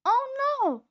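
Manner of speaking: very high, loud shriek; scream. A narrator's reading. Fluffy seeing a steamroller, screaming. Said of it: "screamed Fluffy".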